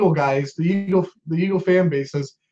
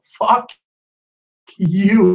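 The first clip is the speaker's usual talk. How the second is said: a hint of a mocking god's taunt, pitched up; mock football gods; 4 semitones higher than usual. Football gods taunting Eagles fans, mock sneer. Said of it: "saying to us".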